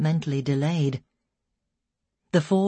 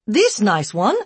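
broken English; 5 semitones higher, 6 dB louder than usual. A narrator's reading. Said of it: "in broken English".

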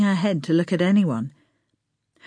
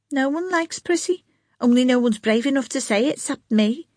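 put-on young girl voice; 7 semitones higher than usual. A narrator's reading. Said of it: "Josephine's defiant reply".

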